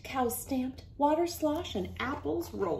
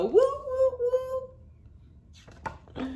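high train whistle. Acted. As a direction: blow the whistle with your voice.

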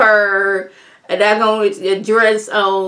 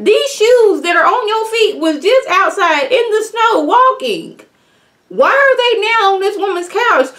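outraged disbelief; 11 semitones higher than usual. Retold or imitated. To a woman with shoes on a host's couch, high outraged scolding. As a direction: shriek at her for feet on the couch. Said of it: "I'm like".

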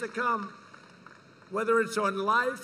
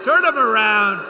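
loud yell at the cameras; shouted demand; 11 dB louder than usual. Real, not acted. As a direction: shout the order. Yelling at the press cameras.

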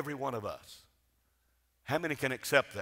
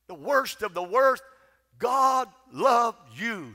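full-force preaching; 5 semitones higher than usual. Acted. Preacher to congregation, proclaiming God's love at full force. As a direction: lift the congregation with each line about God's love.

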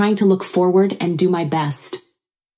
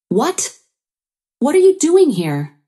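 high, louder indignant challenge; angry questioning; 9 semitones higher, 4 dB louder than usual. A narrator's reading. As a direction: demand an answer, pitch shooting up. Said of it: "Freya questioned angrily".

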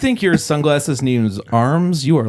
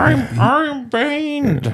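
very slow Bane impression, pitched way up; 14 semitones higher, much slower than usual. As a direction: drag out a villain line as Bane. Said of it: "Do your Bane voice".

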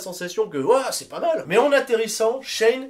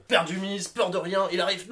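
ordinary talk

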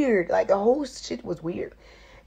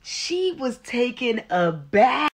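ordinary delivery

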